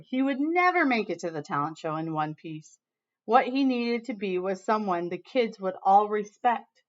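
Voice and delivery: ordinary delivery, nothing marked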